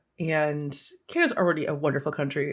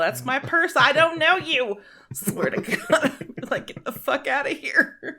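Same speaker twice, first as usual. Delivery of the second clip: high, slowed, indignant cartoon-kid voice; mock-offended cartoon rebuff of a stranger; King of the Hill bit; 10 semitones higher, slower than usual. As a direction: squeak out a huffy, outraged brush-off. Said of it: "Pull some fucking King of the Hill".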